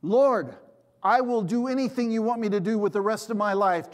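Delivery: high, loud acted pleading; hammed-up climber begging; desperate plea. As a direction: cry out to the heavens in a bargain. Climber pleads while hanging.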